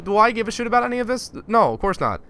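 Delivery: normal talking voice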